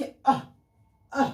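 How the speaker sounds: अह as a short puff of breath from the throat; sound from throat. Shown as a demonstration. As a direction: demonstrate अह, each one separate, from the throat. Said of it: "कंठ से निकल रहा है".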